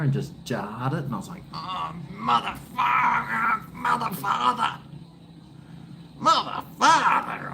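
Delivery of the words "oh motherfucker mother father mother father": high, slow yelp of pain. Retold or imitated. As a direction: cry out as the ball jars your thumb. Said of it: "I said".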